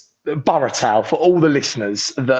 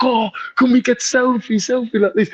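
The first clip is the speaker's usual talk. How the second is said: eager fan; 8 semitones higher than usual. Retold or imitated. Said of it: "the animation team were like".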